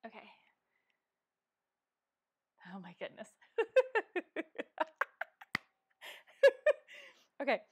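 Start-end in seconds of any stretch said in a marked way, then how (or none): 3.6-5.6 high, loud laugh at the mishap; flustered laugh. Real, not acted. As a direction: laugh through the embarrassment. After her light falls over.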